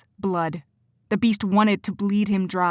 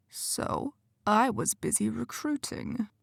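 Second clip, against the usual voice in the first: quiet menace; 3 semitones higher, 7 dB quieter, slower than usual. Acted. Quentin mocks Cora's ignorance, soft and menacing. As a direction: lean in and threaten gently. Villain voice in the story.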